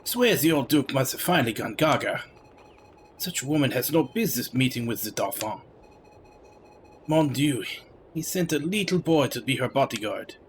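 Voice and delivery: put-on game dialogue read in a thick French accent; heavy French accent. Player voicing a scornful Frenchman from the game, heavy French accent. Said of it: "talking with a heavy French accent".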